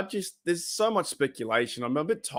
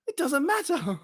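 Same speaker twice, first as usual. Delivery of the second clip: squeaky voice much higher, breaking into laughter; high laughing falsetto; 14 semitones higher than usual. Retold or imitated. Fan quoting the skit while cracking up, high and laughing. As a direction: giggle through a squeaky mock line. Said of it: "And he's like".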